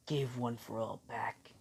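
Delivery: slow, whispered demand; hushed acted order; grave whisper. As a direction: whisper it slowly and solemnly. All Might demands power back.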